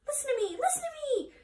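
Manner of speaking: very high shrieking honk; screaming goose. A narrator's reading. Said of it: "screamed the goose".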